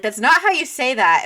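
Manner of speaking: loud, high imitation of an objection; mock correction. Friend imitates Dan mocking their accent, loud corrective protest. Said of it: "He's like".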